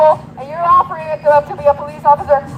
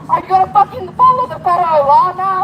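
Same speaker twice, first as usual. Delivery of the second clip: ordinary talk, nothing marked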